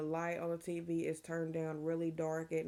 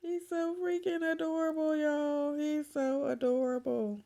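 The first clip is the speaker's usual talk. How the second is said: gushing; 10 semitones higher, 8 dB louder than usual. Real, not acted. Showing the baby to viewers.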